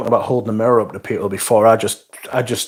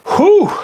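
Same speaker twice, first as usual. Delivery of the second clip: high whew; 6 dB louder than usual. Real, not acted. Reacting to a chat suggestion.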